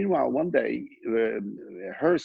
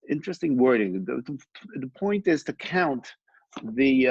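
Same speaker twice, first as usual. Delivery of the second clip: ordinary talk, nothing marked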